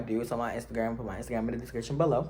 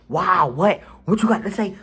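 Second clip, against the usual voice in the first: angry; 6 semitones higher, 7 dB louder than usual. Retold or imitated. Retold angry confrontation.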